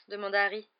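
ordinary delivery, nothing marked

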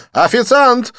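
high, loud call across the bar; calling the waiter. Acted. Didier calling the waiter, high loud call. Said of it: "окликнул Дидье".

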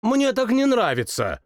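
high, whiny royal complaint; capricious. Acted. King complains to his cousin, high and capricious. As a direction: sulk like a spoiled child king. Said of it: "сообщил капризно".

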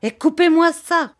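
high, loud order; shouted command. A narrator's reading. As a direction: bark the order to strike. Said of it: "commanda monsieur Lépine".

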